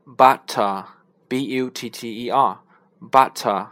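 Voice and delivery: slow butter with a crisp British t; British butter, clear t; British accent. Shown as a demonstration. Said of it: "英国人呢发".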